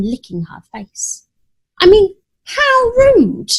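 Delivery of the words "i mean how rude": high, loud, slow outraged huff; pearl-clutching outrage; huffy cat scandalised; indignant. A narrator's reading.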